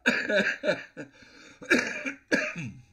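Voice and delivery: loud, high belly laugh; real uncontrollable laughing fit; hard laughter. Real, not acted. Player losing it over Rob's lines, laughing hard. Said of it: "I'm laughing so hard".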